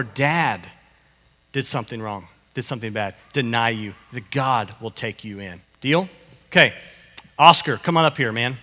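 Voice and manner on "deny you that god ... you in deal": normal talking voice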